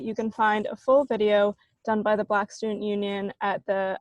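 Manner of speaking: normal talking voice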